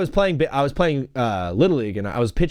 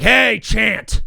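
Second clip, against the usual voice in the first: raised mock-angry order; pushed-up scolding call; mad coach; 5 semitones higher, 4 dB louder than usual. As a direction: holler it like an annoyed Little League coach. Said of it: "your coach getting mad at you".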